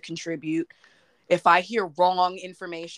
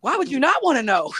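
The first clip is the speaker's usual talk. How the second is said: incredulous; 7 semitones higher, 5 dB louder than usual. Real, not acted. Incredulous at STD remark.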